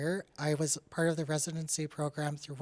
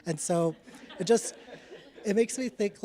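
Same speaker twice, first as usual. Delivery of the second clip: laughing through the words, lifted and louder; real amused laughter breaking in; 5 semitones higher, 5 dB louder than usual. Real, not acted. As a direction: giggle your way through it. Laughing at the sassy-grandma memory.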